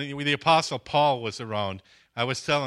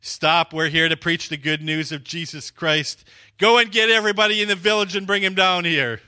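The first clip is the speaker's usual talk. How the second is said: loud, raised-pitch commanding proclamation; retold shouting at mob; 6 semitones higher, 8 dB louder than usual. Two guys ordering the mob to gather the village, shouted. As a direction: build to a high, forceful order. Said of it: "They're screaming at this mob".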